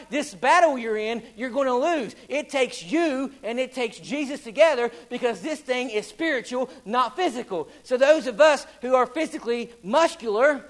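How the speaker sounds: full-force revival preaching. Acted. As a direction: preach it out to the congregation. Preacher in full sermon delivery.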